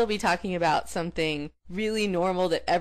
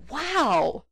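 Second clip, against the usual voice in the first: fake amazement; 9 semitones higher, 4 dB louder, slower than usual. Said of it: "you have to look like".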